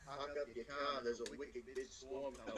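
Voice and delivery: broad Boston voice, dropped r's; Boston accent. Retold or imitated. A Bostonian warning everyone of the coming storm, in a broad Boston accent. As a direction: lay on the Boston accent thick. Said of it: "my Boston accent".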